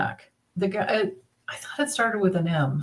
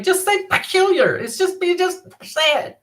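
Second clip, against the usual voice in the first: higher-pitched, mock-exasperated sister; raised-pitch retold sibling nagging; imitating a sister; 8 semitones higher than usual. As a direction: imitate an impatient sister coaching a word. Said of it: "My sister's always like".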